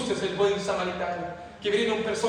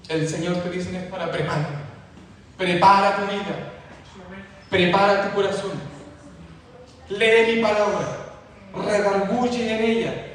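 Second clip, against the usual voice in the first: preaching exhortation; 3 dB louder than usual. Acted. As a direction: call it out over the church.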